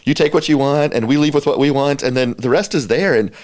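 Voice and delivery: building giddy delight as the staff member; excited retelling. Retold or imitated. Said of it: "I'm laughing 'cause I remember a staff member saying".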